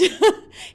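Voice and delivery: bright burst of laughter, high and loud; a real laugh bursting out. Real, not acted. Mother laughing at the minister's answer, a high loud burst.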